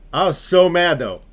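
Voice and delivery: venting real frustration over the ending; heated outburst. Real, not acted. Reliving anger at the game.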